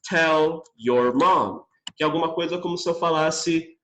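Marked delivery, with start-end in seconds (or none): 0.1-1.6 slow, stressed do not; slow teaching read. Shown as a demonstration. Teacher showing the full negative form, slow. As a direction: spell it out for the class. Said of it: "falar pra você".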